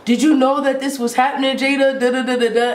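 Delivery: mock cross interrogation; imitating irate dad. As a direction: play dad grilling the stepsister. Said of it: "he gets done screaming at me".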